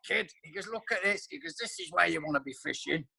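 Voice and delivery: light impression of a fishing pal; impression of Baz. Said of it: "he speaks like this".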